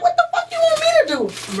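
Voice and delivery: high, fast mock husband voice, laughing; laughing imitation of a confused husband. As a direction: squeak out his baffled reply, laughing. Mocking husband's reply.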